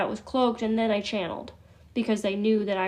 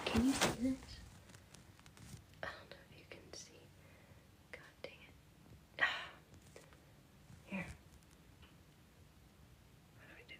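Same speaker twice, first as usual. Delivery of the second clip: whisper; 19 dB quieter than usual. Real, not acted. Struggling to show stone on camera.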